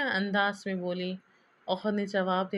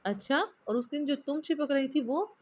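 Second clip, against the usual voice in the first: mischievous; 6 semitones higher than usual. A narrator's reading. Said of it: "वो शरारत से बोला".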